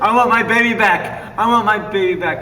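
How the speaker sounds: very high, loud screeching mock parent; over-the-top distraught mother; hammed-up frantic mother. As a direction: burst in shrieking for the baby. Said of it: "enter with the same intensity".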